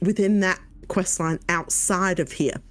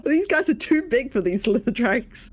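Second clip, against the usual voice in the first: normal talking voice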